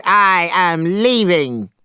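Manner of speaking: high, slow, firm Monkey declaration; angry shout. A narrator's reading. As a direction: storm off with a loud parting shot. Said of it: "he shouted".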